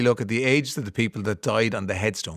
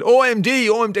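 eager mock shout; 11 semitones higher, 7 dB louder than usual. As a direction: shout the band name like an eager fan. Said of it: "And he said".

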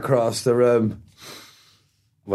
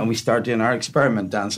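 usual speaking voice

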